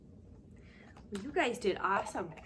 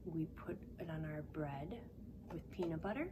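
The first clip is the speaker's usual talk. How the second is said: whisper; 4 semitones lower, 8 dB quieter than usual. Pretend. Whispered clue.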